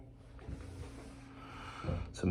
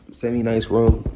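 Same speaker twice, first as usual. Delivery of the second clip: loud, punched semi-nice room; emphatic semi-nice room; 4 semitones higher, 12 dB louder, slower than usual. Real, not acted. Man stressing he lives in a borrowed room, loud emphatic. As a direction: hit semi-nice room hard and high.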